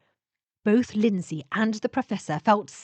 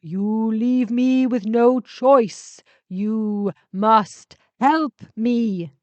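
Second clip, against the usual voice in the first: very slow, heavy command; insistent ship spirit; 2 semitones higher, 6 dB louder, much slower than usual. Acted. Ship spirit repeats its demand, insistent. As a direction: force the demand. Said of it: "Geister repeated".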